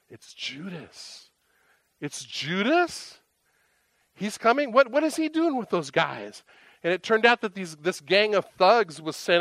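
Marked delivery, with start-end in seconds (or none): 0.1-1.3 very soft, slow, falling statement; hushed realization. Acted. Acted recognition of the betrayer. 2.0-3.2 slow, drawn-out rise to a high ending; incredulous question. Acted. Acted disbelief at seeing Judas. 4.1-6.4 high-pitched, incredulous questioning; baffled disbelief. Acted. Acted bewilderment at seeing Judas.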